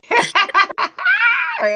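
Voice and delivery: shrill laughing shriek; high shrieking laugh. Real, not acted. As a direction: let out a piercing laugh. Laughing at a friend's joke.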